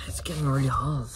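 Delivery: ordinary talk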